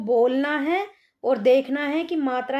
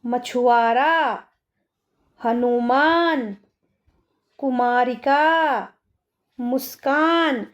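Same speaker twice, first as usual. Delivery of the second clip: slow word dictation; slower than usual. Shown as a demonstration. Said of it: "आगे चलते हैं".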